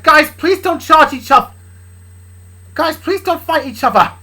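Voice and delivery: high, loud plea to stop; girl begging the men to stop; pleading girl. Acted. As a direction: beg them not to fight. Luna pleading, voiced higher.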